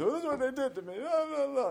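mock whiny victim voice, high and loud; playing the victim. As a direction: whine like a self-pitying victim. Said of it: "my victim".

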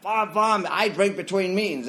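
high, loud comeback with censored curses; mock angry swearing. Drinker cursing at kind meeting members, mock angry outburst. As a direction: throw the bombs out defiantly. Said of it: "I threw out so many F-bombs".

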